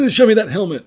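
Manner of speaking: high, loud and keen, pointing at the window; eager demand. Retold or imitated. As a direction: grab the shopkeeper's attention, thrilled.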